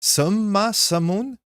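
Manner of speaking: Sama Samun, high and slow, no k; silent K. Shown as a demonstration. Streamer repeating the correction, slow Sama Samun. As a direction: draw out suh-ma sa-moon for Sama Samun. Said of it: "you don't get that K".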